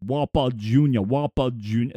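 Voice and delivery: lightly voiced Sean Connery, low; a loose stab at Sean Connery's Scottish burr; Sean Connery attempt. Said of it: "I can't do a Sean Connery impersonation".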